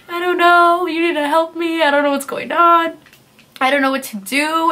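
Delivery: high, loud, half-sung wailing; retold bawling. Sixteen-year-old in the back of the cop car telling her boyfriend she doesn't know, retold bawling. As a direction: wail it out as if sobbing again. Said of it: "And I start bawling".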